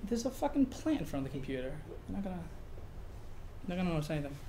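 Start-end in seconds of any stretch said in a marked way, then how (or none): none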